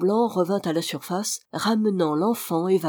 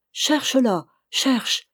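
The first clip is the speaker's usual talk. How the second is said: higher-pitched, pressing order; repeated, more urgent command; 6 semitones higher, much slower than usual. A narrator's reading. Vaunois sending his dog while reloading, urgent and higher. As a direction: urge the dog on in a raised pitch. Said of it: "répéta monsieur de Vaunois, qui rechargeait vivement".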